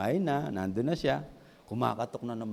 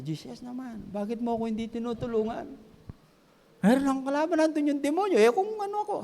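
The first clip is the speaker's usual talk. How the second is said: high, pleading whine; frightened plea; 13 semitones higher than usual. Retold or imitated. Homeowner begs Jesus for help, high frightened whine.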